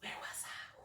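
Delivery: unvoiced whisper, collecting herself; soft whisper after tears; whispered aside. Real, not acted. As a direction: breathe the words while regrouping. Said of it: "So, um".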